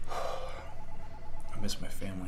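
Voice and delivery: soft breathy sad sigh; slow, deflated and quiet. Acted. Sudden sad confession.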